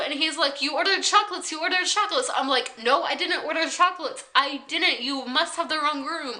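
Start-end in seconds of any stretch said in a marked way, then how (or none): 0.6-2.3 higher, insistent repeat of "ordered"; insistent worker. Retold or imitated. Worker insisting to guest, higher and pushy. 2.8-6.4 higher, urgent denial without yelling; panicked but not shouting; freaking out. Retold or imitated. Guest denying the order to worker, panicked. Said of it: "I'm not yelling, but I was freaking out".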